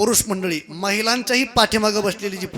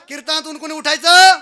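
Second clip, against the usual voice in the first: high, loud shouted question; rousing call for a response; loud crowd prompt; 8 semitones higher, 10 dB louder than usual. Shown as a demonstration. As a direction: shout it so the whole hall answers as one. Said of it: "मग सर्वांनी एका आवाजात बोलायचं आता".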